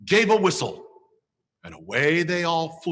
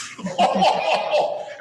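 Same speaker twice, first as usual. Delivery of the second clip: Santa chuckle; 11 semitones higher than usual. Acted. Santa laughs at own joke.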